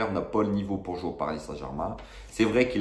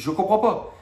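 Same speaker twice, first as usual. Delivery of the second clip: exasperated disbelief; 11 semitones higher than usual. Real, not acted. Incompréhension exaspérée.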